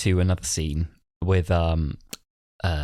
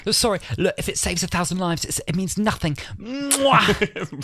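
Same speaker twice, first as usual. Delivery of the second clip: high-pitched mock apology into a kiss; imitated hasty excuse; 11 semitones higher than usual. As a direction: gabble an excuse, end on a big smack. Acts out Doctor's excuse and kiss.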